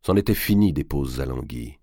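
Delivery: ordinary delivery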